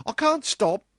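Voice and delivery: put-on loud, very high, hurried girl; panting girl. A narrator's reading. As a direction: call it out high, as if rushing off. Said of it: "she panted".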